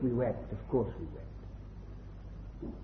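whispered, slow and breaking with grief; choked whisper. Real, not acted. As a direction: barely get the words out, near tears. Grief recalled, voice collapsing.

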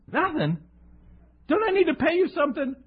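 high, fast incredulous question; flustered disbelief. Retold or imitated. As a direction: sputter in disbelief. His flustered reply to cashier.

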